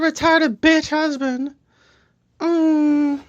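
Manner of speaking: high, slow caricature woman's voice; Melania Trump. Acted. First lady denies an affair, high caricature impression. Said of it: "my name is Melania Trump".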